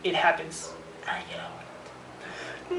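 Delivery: breathy whispered shrug. Acted. Vlogger whispering to viewers that lost ideas happen, in a whisper. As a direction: let it slip out as a resigned whisper.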